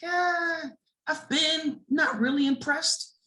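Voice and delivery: high, drawn-out unimpressed yeah. Retold or imitated. To young riders asking about zoo lights, mildly unimpressed.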